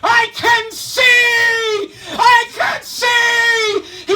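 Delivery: very high, very loud, slow joyful yell; healed man screaming with joy; ecstatic shout. Acted. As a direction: cry it out like sight just came back. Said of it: "the blind man started to yell".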